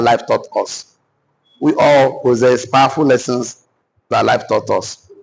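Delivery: deliberate, phrase-by-phrase dictation; drawn-out openings, pauses for writing. Acted. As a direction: spell out each phrase for note-takers, pausing between. Dictates notes to the audience.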